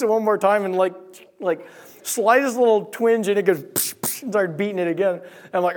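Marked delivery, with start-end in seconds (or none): 3.6-4.2 voiceless "psh" stabbing noises; shovel stab noises. Retold or imitated. Son imitates mom stabbing the snake, with breathy psh noises. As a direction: spit out breathy shovel-strike sounds.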